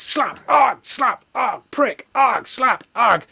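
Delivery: high, loud yelps between slaps; mock pained grunts. [name] acting out being slapped, rhythmic yelps. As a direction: yelp on each hit like a cartoon.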